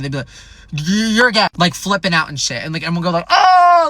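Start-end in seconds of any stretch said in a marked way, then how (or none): 0.7-1.5 higher, stammered mock outburst; mad kid. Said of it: "when kids were really, really mad". 3.3-3.9 high, loud drawn-out ooh; crowd jeer. Retold or imitated. Crowd reacting to a schoolyard insult.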